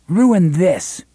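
defiant retort. A narrator's reading.

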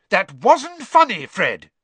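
loud, high-pitched furious scolding; angry shout. A narrator's reading. Angry father confronting Fred over a prank, shouting. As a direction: shout it at a misbehaving son. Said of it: "he shouted".

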